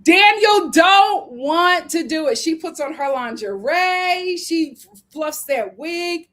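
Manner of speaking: high, slow, drawn-out delivery, opening loud; emphatic exclamation. Real, not acted. Recapper dramatising the hotel rejection, loudly emphatic. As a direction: proclaim it with dragged-out words.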